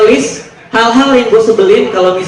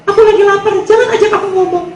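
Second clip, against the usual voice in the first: high mock-girlfriend voice, much higher than his own; girly falsetto; 11 semitones higher than usual. Hungry girlfriend answering her boyfriend, in high mock falsetto. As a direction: squeak it like a whiny girlfriend.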